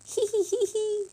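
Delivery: very high little bat titter; piping creature cackle; squeaky giggle. A narrator's reading. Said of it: "squeaked Flit".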